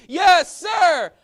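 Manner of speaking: high, loud soldier's shout; shouted yes sir. Shown as a demonstration. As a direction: bark it out like a drill recruit. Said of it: "sing this song and loud and proud".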